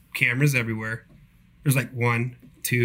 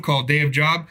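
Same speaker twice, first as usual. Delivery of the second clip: ordinary delivery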